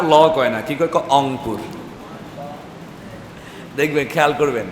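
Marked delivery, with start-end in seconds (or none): 1.1-1.6 অংপুর, Rangpur with its first consonant left off; dropped R. Retold or imitated. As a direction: imitate locals opening Rangpur on a bare vowel, অংপুর. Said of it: "অনেকে র কয় না".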